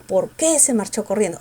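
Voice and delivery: "qué" carries the force in "por qué"; stress on QUÉ. Shown as a demonstration. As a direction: lean on "qué", pass lightly over "por". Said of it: "el qué tiene fuerza".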